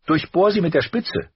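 blurted out. A narrator's reading. Arya blurting the sword lesson, high and loud. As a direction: blurt it before thinking. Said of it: "platzte sie heraus".

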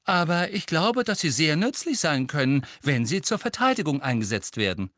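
clear, audible voice. A narrator's reading. Hermine argues with her teacher before the whole class, clear and audible. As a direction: speak up clearly for everyone to hear. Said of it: "mit klarer, vernehmlicher Stimme sprach".

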